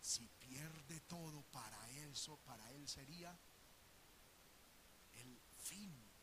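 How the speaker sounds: low, soft, slow scheming devil; sly devil. Retold or imitated. Satan overhearing fears, low sly scheming. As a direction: purr it like a lurking lion. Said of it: "Satanás ... está oyendo".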